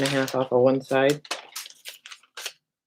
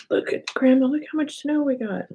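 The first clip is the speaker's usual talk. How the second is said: higher-pitched excited grandchild impression; imitating grandkids; 9 semitones higher than usual. Grandkids calling Grandma about the snow, imitated in a high voice. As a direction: play a child showing off to Grandma. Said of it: "The grandkids call me and say".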